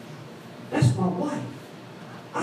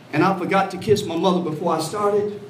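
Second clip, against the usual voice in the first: normal talking voice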